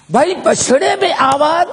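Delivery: amazed cry. Acted. Crowd amazed by calf's voice.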